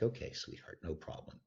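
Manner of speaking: retold meek murmur, barely voiced; hushed, soothing agreement; soft placating reply. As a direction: back off very softly. Masseuse placating him, retold.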